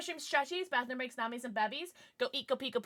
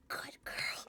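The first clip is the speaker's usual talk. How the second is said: soft teasing whisper; 12 dB quieter than usual. Acted. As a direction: breathe the praise as a tease. Teasing praise after she agrees.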